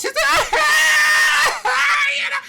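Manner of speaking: loud, high-pitched comic wail; exaggerated shrieking bawl; mock bawling. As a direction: burst into hammed-up sobbing at full volume. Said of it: "she just bawls".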